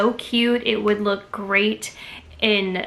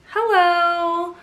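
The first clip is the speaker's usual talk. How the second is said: excited hello; 8 semitones higher, 3 dB louder than usual. Real, not acted. Greeting farmhouse decor.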